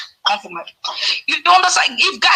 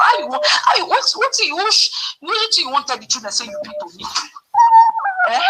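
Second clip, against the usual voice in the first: incredulous challenge; 12 semitones higher than usual. Real, not acted. Challenging him about the children.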